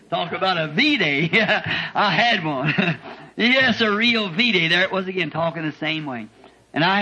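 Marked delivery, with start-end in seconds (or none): 0.1-4.7 real laughter running through the words; preacher laughing with joy at his V-Day. Real, not acted. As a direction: laugh out the memory of victory. Laughing joy recalling victory.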